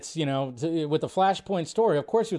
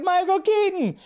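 high excited yell of the name. Retold or imitated. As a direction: shout it out to the whole room. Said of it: "I just yelled it out".

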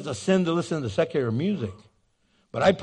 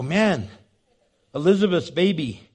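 ordinary delivery

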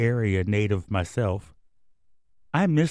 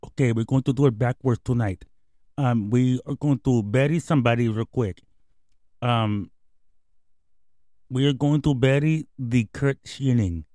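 lightly voiced Dominican slugger, broken English; a hint of David Ortiz's Spanish-accented English. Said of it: "Thank you, David Ortiz, our special guest".

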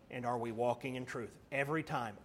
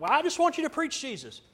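high, mocking church member voice; a hammed-up nagging parishioner; mock whiny congregant; 12 semitones higher, 6 dB louder, faster than usual. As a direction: parody a member asking for Jesus preaching.

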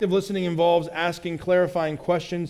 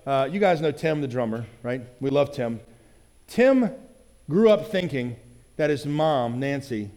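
usual speaking voice

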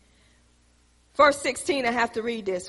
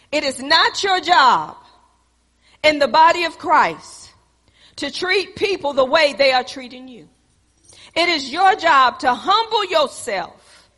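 rising sermon; 4 semitones higher, 5 dB louder than usual. Acted. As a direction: exhort the church, building as you go. Preaching to congregation.

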